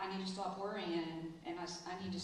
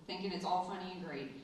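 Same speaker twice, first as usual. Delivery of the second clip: normal talking voice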